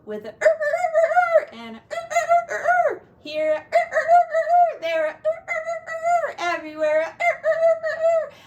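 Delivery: high, loud mock rooster crow. Parent crowing like the rooster in the song, high playful crow.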